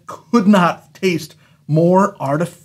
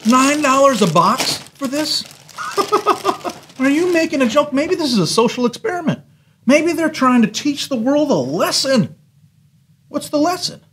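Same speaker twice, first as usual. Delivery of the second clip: incredulous; 10 semitones higher than usual. Real, not acted. Mocking outrage at price.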